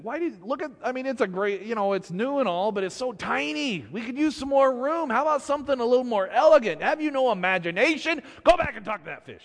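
high, insistent complaining; dissatisfied wife. Acted. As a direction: nag him, building to a command.

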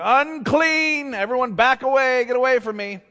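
high, loud acted warning cry; yelled warning. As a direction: yell it out to keep people away. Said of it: "supposed to go around yelling".

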